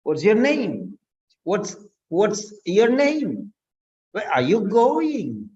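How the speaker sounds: slow demo, "name" and "going" arching up then down; up-and-down question tune. Shown as a demonstration. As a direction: swing the pitch up and over on "name" and "going". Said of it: "The way how your tone falls up and down".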